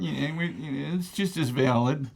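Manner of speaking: high mocking voice of a hippie relativist.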